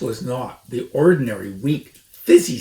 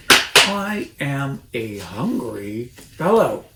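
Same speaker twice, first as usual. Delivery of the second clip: menacing fox; 4 semitones higher, 7 dB louder than usual. A narrator's reading. Fox threatens the rat.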